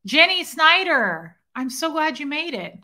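high, loud, drawn-out name; delighted greeting. Real, not acted. Welcoming Jenny Snyder to the stream, delighted. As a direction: greet them high and thrilled.

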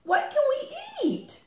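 very high tiny chick voice; little chick. Acted. First little chick asking Mother Hen for food, tiny high voice. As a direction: squeak it like a baby chick. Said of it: "Said the fifth little chick".

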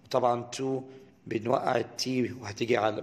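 ordinary delivery

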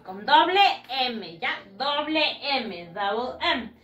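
ordinary talk, nothing marked

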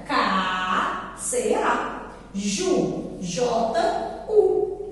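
"K" drawn out low, then "C, A", then "Ju, J, U"; split and spelled. Shown as a demonstration. Teacher spelling caju for children, syllable by syllable. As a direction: spell each syllable in separate beats, "K" and "Ju". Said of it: "Como é que você vai separar as sílabas".

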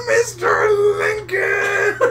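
very high, stretched-out imitated holler; drawn-out mock cry. Re-enacting how a friend called out in their old game, drawn-out mock cry. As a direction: mimic a friend hollering the name, slow and high.

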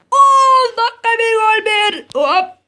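slow high loud announcer call; commentator voice. Acted. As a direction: draw it out like a stadium announcer. Announcing score dramatically.

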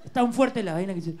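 ordinary delivery

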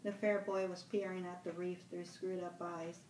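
plain reading voice

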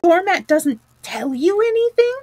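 high-pitched incredulous question; excited. A narrator's reading. Excited rat presses mole on the doormat clue, incredulous. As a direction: push the pitch up in excited disbelief. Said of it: "cried the excited rat".

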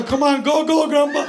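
high-pitched coaxing cheer; eager, high encouragement; urging on. Acted. Acts urging grandpa on.

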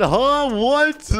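high-pitched incredulous reaction; exaggerated shocked questioning; mock disbelief. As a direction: imitate a baffled player, voice shooting up. Acting out players' shocked reply.

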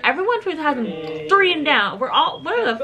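high, laughing disbelief. Real, not acted. Laughing at tall younger members.

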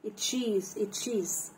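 "اتشيز" with t joined to sh. Shown as a demonstration. Teacher giving an example of CH, "اتشيز" with t-sh. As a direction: sound t plus sh in "اتشيز", falling. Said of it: "ته مع شين".

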